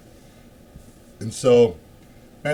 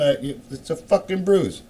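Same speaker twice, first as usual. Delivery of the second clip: normal talking voice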